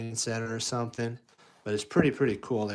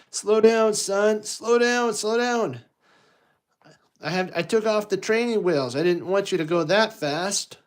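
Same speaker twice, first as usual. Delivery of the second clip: Darth Vader as a worried dad; 10 semitones higher, 6 dB louder than usual. Acted. Darth Vader calling after Luke on his bike, high and anxious. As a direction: call after your son like an anxious father. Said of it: "Darth's like".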